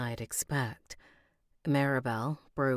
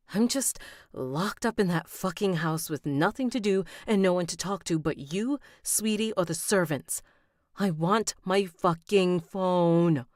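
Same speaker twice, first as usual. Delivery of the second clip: angry rant; 5 semitones higher, 5 dB louder than usual. Acted. Heroine rants at King's mother.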